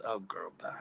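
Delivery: breathy whispered brush-off; whispered dismissal. Real, not acted. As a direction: whisper it, waving her off. Dismissing a ridiculous chat comment.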